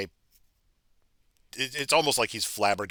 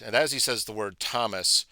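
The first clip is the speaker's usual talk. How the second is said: usual speaking voice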